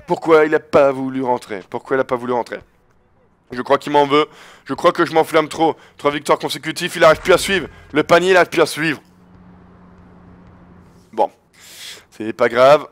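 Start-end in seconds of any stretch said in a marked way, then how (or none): none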